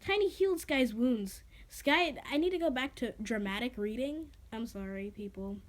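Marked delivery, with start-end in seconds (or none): none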